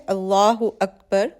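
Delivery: calm prayer-call recitation of Allah Akbar; recited takbir. Shown as a demonstration. Teacher recites the opening takbir of prayer. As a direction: intone it as at the start of prayer. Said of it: "نماز کا آغاز تکبیر تحریمہ سے".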